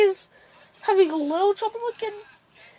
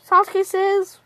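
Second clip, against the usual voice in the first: ordinary delivery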